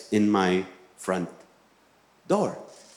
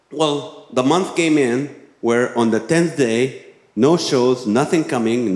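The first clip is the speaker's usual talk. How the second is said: usual speaking voice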